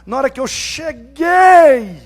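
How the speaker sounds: slow, loud shout of the phrase; preacher shouting to stir the church; sudden shout. Acted. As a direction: burst out at the congregation. Sermon emphasis burst.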